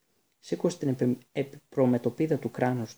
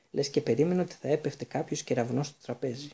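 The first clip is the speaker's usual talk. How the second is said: ordinary delivery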